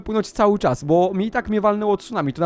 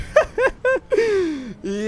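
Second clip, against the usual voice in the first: long high-pitched laughing cry; drawn-out high squeal; high laughing wail. Real, not acted. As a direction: let out a squealing laugh that falls away. Hit by the tsunami.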